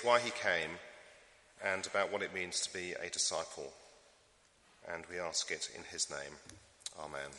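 soft, reverent prayer voice. Acted. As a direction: pray gently over the congregation. Leads the congregation in prayer.

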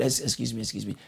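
a quiet, knowing excuse me; soft pointed interruption. Real, not acted. Preacher cutting off a self-pitying excuse, quietly pointed.

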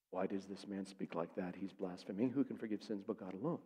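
low, hushed inner thought; private questioning. Acted. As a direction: murmur it as if thinking to yourself. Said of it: "questioning in their hearts".

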